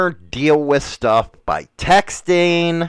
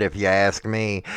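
usual speaking voice

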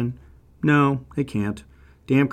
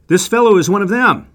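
high and loud, pressing the point; insistent accusation; 8 semitones higher, 8 dB louder than usual. Acted. Servant girl tells bystanders about Peter, loud and insistent. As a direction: she won't let it go, tell everyone.

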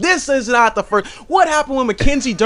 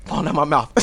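ordinary talk

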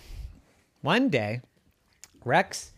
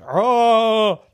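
effort cry; 8 semitones higher, 7 dB louder than usual. Acted. Power pose effort.

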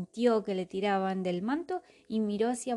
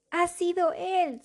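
defensive; 9 semitones higher, 4 dB louder than usual. Acted. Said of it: "se defendió Maldeocus".

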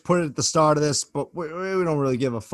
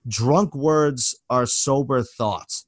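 said slowly, word by word; slow quoted phrase; 2 semitones lower, much slower than usual. Shown as a demonstration. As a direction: spell out the hated phrase slowly. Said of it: "one of my most hated phrases".

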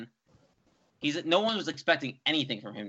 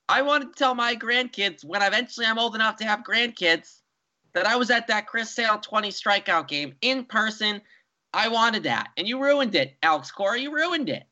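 high mock-whining fan voice; a lightly voiced whining fan blaming Alex Cora; whiny fan; 8 semitones higher than usual. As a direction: pout and complain like a sore fan. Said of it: "whine about it there".

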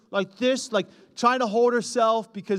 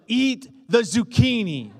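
louder, slower, stern order to eat; angry command; 3 semitones higher, 7 dB louder, slower than usual. Retold or imitated. Dad loses patience with daughter at dinner, stern and louder. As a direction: bark it at a stubborn child, drawn out. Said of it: "I'm getting angry".

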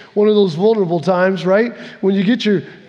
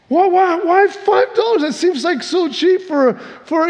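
very high, sputtering repeated why; baffled disbelief; 9 semitones higher than usual. Acted. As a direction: stammer in astonished confusion. Buyer baffled by cheap price.

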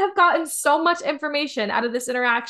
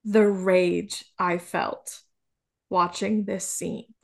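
slow seething rage; 5 semitones lower, much slower than usual. Real, not acted. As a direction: drag it out slowly, low and heavy. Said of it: "I cannot explain to you".

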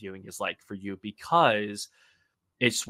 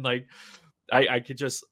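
usual speaking voice

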